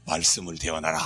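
very low, hushed pronouncement; divine command. Acted. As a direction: intone it deep and quiet, like God answering. Acts out God's answer again. (낮게 깔린 엄숙한 목소리)

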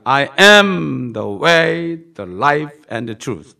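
very slow, loud, word by word; drawn-out preaching of "I am good shepherd"; slow intoned scripture. Acted. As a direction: lay each word down like a proclamation.

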